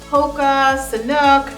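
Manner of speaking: usual speaking voice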